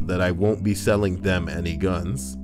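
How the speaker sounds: ordinary talk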